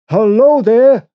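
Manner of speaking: high, loud hail into the dark; calling out. Acted. Narrator calls to the unseen boat, loud hail. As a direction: shout to someone out on the water. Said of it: "I called".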